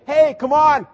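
a hammed-up happy invitation; mock jubilant. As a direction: burst out like a party host. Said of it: "he didn't say".

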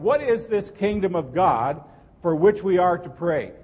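normal talking voice

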